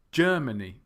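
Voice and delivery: Germany as GER-muh-nee; first-syllable stress. Shown as a demonstration. Teacher modelling the right word, GER stressed in Germany. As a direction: GER loud and strongest in Germany. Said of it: "stress is on the first syllable".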